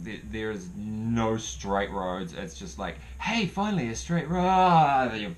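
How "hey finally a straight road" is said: high, loud, drawn-out cheer; mock elation. Lost rider finally finding a straight road, exaggerated joy. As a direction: crow it slowly with mock delight.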